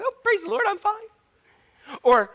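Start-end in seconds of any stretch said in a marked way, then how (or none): 0.0-1.1 very high, chirpy fake praise. Mocking Christianese, very high chirpy praise. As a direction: trill it like a churchy smile. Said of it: "like, talk".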